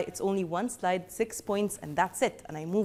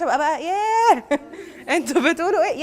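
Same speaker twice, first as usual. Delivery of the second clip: high, loud demo yell breaking into laughter; mock shouting; 6 semitones higher, 4 dB louder, much faster than usual. Shown as a demonstration. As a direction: overdo a shout, then laugh. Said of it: "I shouldn't be shouting".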